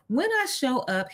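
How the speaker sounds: normal talking voice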